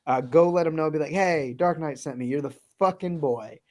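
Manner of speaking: normal talking voice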